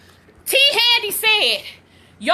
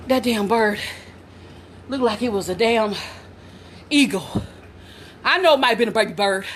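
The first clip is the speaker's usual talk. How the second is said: usual speaking voice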